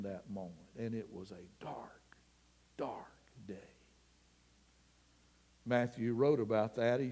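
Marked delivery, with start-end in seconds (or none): 0.0-3.5 hushed whisper. Real, not acted. Hushed ending on a dark day.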